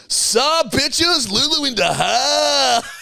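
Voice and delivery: high, slow swaggering party entrance; brash entrance. Retold or imitated. Lou kicking open the party door, brash high swagger. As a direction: strut in and own the room.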